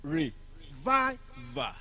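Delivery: REVIVER split into three slow, separate syllables; broken into syllables. Shown as a demonstration. Teacher counting syllables for the class, slow syllable-by-syllable reviver. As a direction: say REVIVER syllable by syllable, long pauses between. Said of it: "Three syllables".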